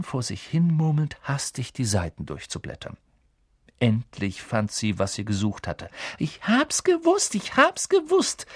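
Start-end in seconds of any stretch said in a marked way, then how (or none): 6.2-8.5 very high, slow squeal of triumph; triumphant excitement. Acted. Girl finding the answer in her book, triumphant. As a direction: draw it out high, thrilled. Hermine finds what she sought.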